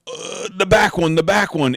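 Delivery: high, loud, excited hunting guide; urgent pointing-out. Retold or imitated. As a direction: urgently call out which animal to shoot. Acting out urgent calls to shoot.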